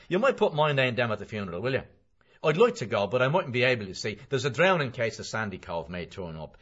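a bustling, insistent McCoy; McCoy, chatty acquaintance. Acted. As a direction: press a favour with cheery urgency. McCoy's voice held.

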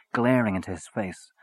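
ordinary delivery, nothing marked

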